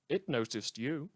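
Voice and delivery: ordinary delivery, nothing marked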